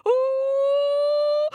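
very high frightened ooh; scared monkey squeal. Acted. Monkey facing the dogs, high scared squeal.

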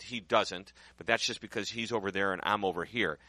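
ordinary talk

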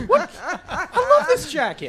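high-pitched, laughing protest; mock offended. Intern to host mocking the jacket, playfully offended. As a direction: jokingly squeal in defense.

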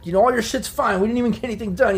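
high-pitched, insistent captive; protesting prisoner. Acted. Game master as intimidated prisoner excusing himself, protesting. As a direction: protest your innocence to your captors. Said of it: "he's kinda like".

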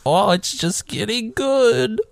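high, drawn-out old lady impression; mock old-lady relish. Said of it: "the younger old lady's like".

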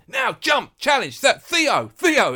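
high-pitched touchline yell, retold; imitated shouting. Coach urging Theo on from the touchline, imitated shouting. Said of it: "he's shouting".

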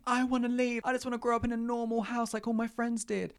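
put-on a kid pleading to move out; whiny child voice. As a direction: play her younger self begging to leave. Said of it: "I was that kid that was saying".

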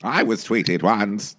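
slow, drawn-out movie star impression; Katharine Hepburn. Podcast host showing off a star impression for co-host, Katharine Hepburn. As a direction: stretch it out like a grand screen actress. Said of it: "That was my Katharine Hepburn".

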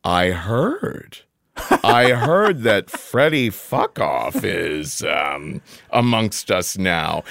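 slow, measured quote of a director; a hint of a director, deliberately drawn out; lightly voiced director. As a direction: imitate a director slowly passing on gossip. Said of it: "she was like".

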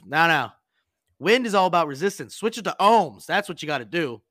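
deadpan authority pushing the prank further; mock expert advice. Master chief gives fake advice.

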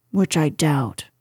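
a touch of low donkey gloom; gloomy. A narrator's reading. Glum donkey greeting the bear, mildly gloomy. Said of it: "said Eeyore gloomily".

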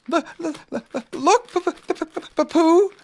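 high, stuttering little Piglet; frightened stammer. A narrator's reading. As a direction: stumble nervously on the first sounds, pitched high.

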